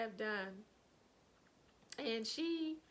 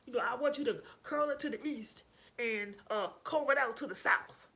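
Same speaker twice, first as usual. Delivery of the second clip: loud, halting bossy client voice, much louder; intimidating client; 5 dB louder than usual. Retold or imitated. Imitating the client giving confusing curl directions, loud and halting. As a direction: bark the orders with lots of uhs. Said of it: "She's like".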